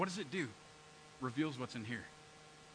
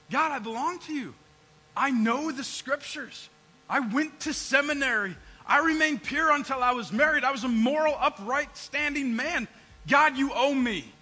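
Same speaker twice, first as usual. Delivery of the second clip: loud, higher, self-righteous demand; entitled Jonah; 6 semitones higher, 9 dB louder than usual. Retold or imitated. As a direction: list your merits and demand payment. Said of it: "Jonah's feeling entitled".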